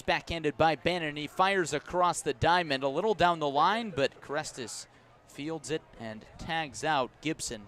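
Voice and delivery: usual speaking voice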